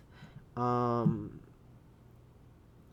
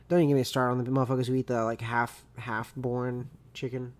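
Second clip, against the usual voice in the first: ordinary talk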